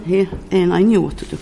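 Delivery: usual speaking voice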